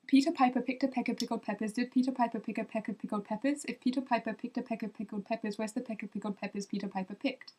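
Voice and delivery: quick Peter Piper rattled off; fast. Shown as a demonstration. Trying the English twister again for viewers, fast. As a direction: race through the peppers. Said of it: "Try it faster".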